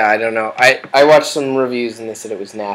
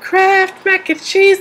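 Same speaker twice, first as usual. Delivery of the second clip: high, slow cartoon voice; silly high voice; slower than usual. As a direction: repeat it in a goofy high pitch. Repeated in silly voice.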